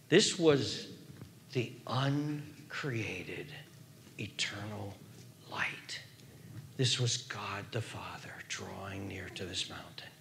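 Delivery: low, very soft near-whisper; hushed awe. Real, not acted. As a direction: whisper it as a holy mystery. Awed reveal of God's presence.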